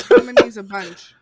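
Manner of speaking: loud. Real, not acted. Eager about getting the possum.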